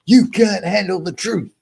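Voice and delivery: movie line outburst. Acted. Quoting famous film line at doubters.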